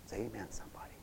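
hushed whisper to the congregation; whispered amen call. Real, not acted. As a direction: draw them in with a whisper.

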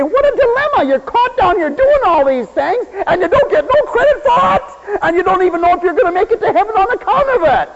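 mock complaining believer. Preacher mimics a believer who wants credit, high and whiny. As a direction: pitch it up like a self-pitying martyr. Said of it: "So you say".

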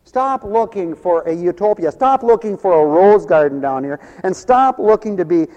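rising pulpit fervour; full-force preaching. Acted. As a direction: preach it insistently, climbing to a peak.

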